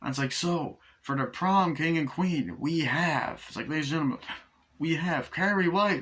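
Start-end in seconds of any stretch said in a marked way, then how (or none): 0.3-3.4 slow, high announcer build-up; prom announcer. Retold or imitated. Host announces prom king and queen, announcer style. 4.8-6.0 prom announcer. Retold or imitated. Host announces prom king and queen, announcer style.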